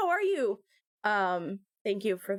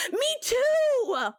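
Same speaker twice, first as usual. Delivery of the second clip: excited; 13 semitones higher, 4 dB louder than usual. Real, not acted. Eager agreement to chat.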